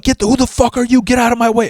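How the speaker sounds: very high, fast, aggressive mock yell; caricatured brute yelling; furious shove-off. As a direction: bark at her to move. Husband shoving the old lady.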